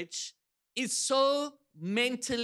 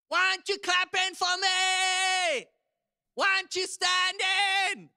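acted-out tantrum yell, pitched way up; mock scream; 8 semitones higher than usual. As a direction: yell it like an outraged player demanding applause. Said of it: "So he literally screams".

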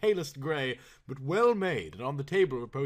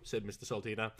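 ordinary delivery

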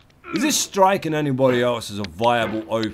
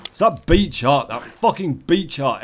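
ordinary talk

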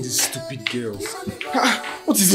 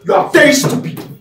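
raised, high-pitched outburst; angry insult; 12 semitones higher, 6 dB louder than usual. Acted. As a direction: shout it at her in anger. Insulting her in the quarrel.

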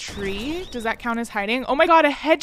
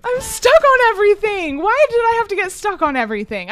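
frustrated yell; 9 semitones higher, 10 dB louder than usual. Real, not acted. Stuck on a box in game.

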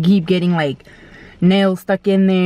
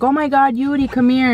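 high, urgent call to Yuri; excited niece; 6 semitones higher than usual. Retold or imitated. Niece spots the carved car and calls, higher and urgent. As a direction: call her over, alarmed. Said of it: "my niece is like".

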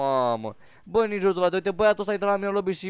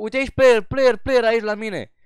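louder, slowed call pointing out an enemy; urgent callout; 4 semitones higher, 6 dB louder than usual. Real, not acted. Player alerts teammate to an enemy nearby, urgent callout.

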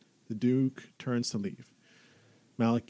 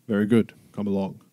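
booming Duke voice; 6 dB louder than usual. Acted. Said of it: "big booming voice".

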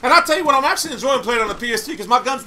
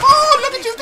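high, delighted taunt; spring the trap with glee; gleeful; 5 dB louder than usual. Real, not acted. To an enemy he found, a high gleeful taunt.

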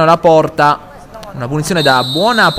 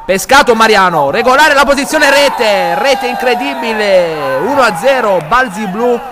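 high, excited goal call; breathless Italian goal commentary; 5 semitones higher than usual. Real, not acted. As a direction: shout out the goal, rising with joy. Goal scored, live commentary.

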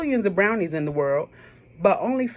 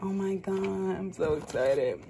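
normal talking voice

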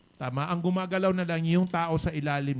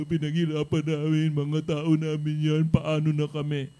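louder whiny complaint, mocked; loud mock lament over lost downline; mocking whine; 6 dB louder than usual. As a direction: imitate their self-pitying protest, louder. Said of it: "and Ram will say".

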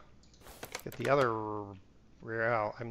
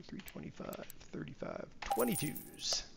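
muttered to self; 2 semitones higher, 11 dB quieter than usual. Real, not acted. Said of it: "repeating tire size to self".

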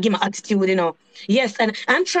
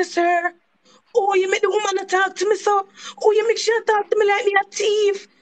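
higher, indignant reproach; retelling how she confronted the man, indignant; indignant complaint; 7 semitones higher than usual. Retold or imitated. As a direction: call him out, offended.

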